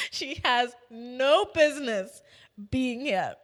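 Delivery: annoyed teacher. Retold or imitated. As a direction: imitate a teacher's exasperated verdict. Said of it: "She's just annoyed".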